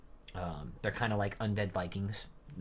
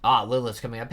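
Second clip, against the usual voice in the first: ordinary talk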